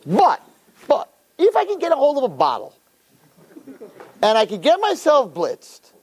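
high-pitched, mock-excited bargaining; eager bargaining. Acts bargaining with Hashem.

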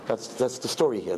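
normal talking voice

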